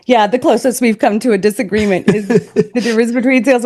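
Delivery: amused words broken by chuckles; laughing while talking. Real, not acted. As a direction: talk on through a laugh. Jokes about their only disagreement.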